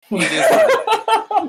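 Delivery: high, slow laughing shrug; a real laugh through the words. Real, not acted. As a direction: laugh it off, half embarrassed. Laughing off his embarrassment.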